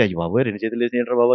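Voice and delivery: hushed, quick startled cry; a pastor alone in the dark church gasps in fright; frightened gasp. Retold or imitated. Scared hushed gasp, rushed. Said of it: "గుండె ఆగినంత పనైంది".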